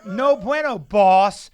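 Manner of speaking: loud mock Spanish "No bueno, boss". [name] mocking his co-host's texts, loud mock Spanish. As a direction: bark "boss" in a hammed-up Spanish accent. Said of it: "He becomes Spanish".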